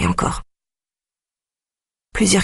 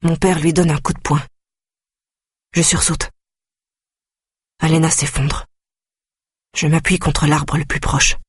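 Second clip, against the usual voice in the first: plain reading voice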